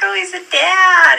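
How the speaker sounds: high, gushing little coo; charmed, cute. Retold or imitated. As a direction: pitch it way up like you find it adorable. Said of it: "I thought it was kinda cute".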